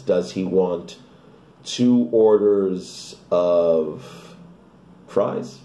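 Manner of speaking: very slow, drawn-out "two orders of", then "fries"; model intonation. Shown as a demonstration. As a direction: stretch "two orders of" out slowly for learners. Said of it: "Bem, entonação". (entonação bem marcada, "two orders of" bem devagar)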